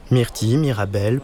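usual speaking voice

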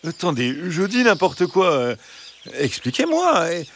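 high, imploring fox voice; pleading fox. Acted. Fox pleading with the hens, high and imploring. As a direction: beg for his freedom as the fox. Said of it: "Implora le renard".

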